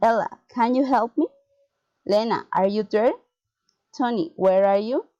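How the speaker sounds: questions lifted at the end, "me", "there", "you"; rising intonation. Shown as a demonstration. Teacher models direct address, rising intonation to "me", "there", "you". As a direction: call the name, then climb to "you". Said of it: "rising intonation".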